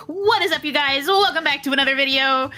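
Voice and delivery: YouTuber intro voice. Retold or imitated. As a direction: open the video like an overexcited YouTuber. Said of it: "the social media influencer voice".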